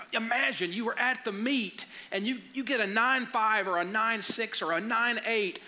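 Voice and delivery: put-on high-pitched, rousing speech to a child; retold fired-up dad speech; motivational pep talk. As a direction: paint the victory like a movie coach. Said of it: "like Rocky, like giving my son a speech".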